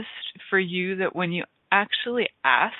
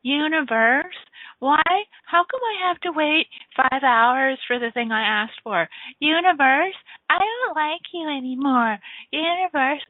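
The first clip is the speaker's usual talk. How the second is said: whiny complaining to the universe; 8 semitones higher than usual. Acted. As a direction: whine at the sky like a spoiled child. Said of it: "Instead of going".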